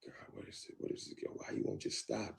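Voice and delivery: hushed frustrated muttering to himself; soft exasperated inner voice; exasperated whisper. Retold or imitated. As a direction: whisper it in disbelief.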